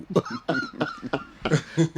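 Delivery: burst of laughter. Real, not acted. Host laughing at the rival fan's chant. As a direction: laugh out at the joke.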